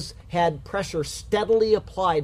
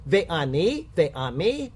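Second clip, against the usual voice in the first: ordinary talk, nothing marked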